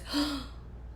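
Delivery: soft gasp of recognition, imitated; mock startled gasp. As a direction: gasp like an astonished old lady. Old lady looks up, amazed.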